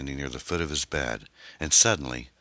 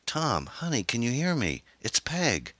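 high-pitched wife's voice; anxious wife; 10 semitones higher than usual. A narrator's reading. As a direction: call gently but urgently to a waking husband, high. Wife calling to waking husband.

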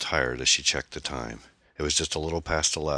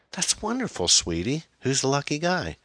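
delighted mother; 11 semitones higher than usual. A narrator's reading. Mother hears daughter's homecoming news, delighted. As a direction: pitch swooping up in pleased surprise.

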